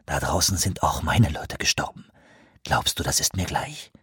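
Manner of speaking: low, soft, bitter hiss. A narrator's reading. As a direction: hiss it close to his face. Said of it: "zischte er".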